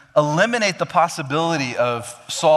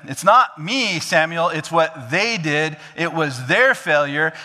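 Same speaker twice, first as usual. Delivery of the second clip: high, louder defensive whine; Saul blaming others; 5 semitones higher, 4 dB louder than usual. Acted. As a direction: point the finger, voice pushed up.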